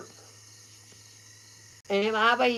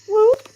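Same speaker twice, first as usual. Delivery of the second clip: sharp high whoop, much louder and rising; imitated whoop call; 11 semitones higher, 10 dB louder than usual. Mimicking a Sasquatch whoop for listeners, high and loud. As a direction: let out a quick rising whoop like a forest call. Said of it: "and we went".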